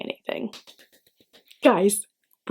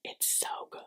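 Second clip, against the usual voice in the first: whisper; 20 dB quieter than usual. Acted. Whispered spoiler to viewers.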